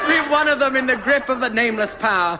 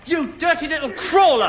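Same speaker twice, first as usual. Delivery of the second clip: angry shout; 7 semitones higher than usual. Acted. Boy shouting at tattletale.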